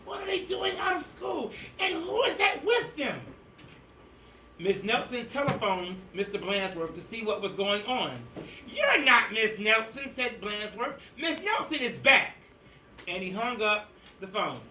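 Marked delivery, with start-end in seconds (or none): 0.1-3.2 much higher, rasped shout; a scratchy outraged teacher, up high. Acted. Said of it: "she said in a scratchy voice". 8.7-10.1 insistent principal. Acted. 11.2-12.4 higher, louder brushing off; a smug principal sure he's right; insistent principal. Acted.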